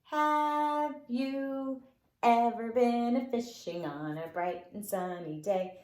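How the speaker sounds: ordinary delivery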